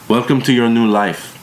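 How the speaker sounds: a hint of a strong German accent. A narrator's reading. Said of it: "She had a strong German accent".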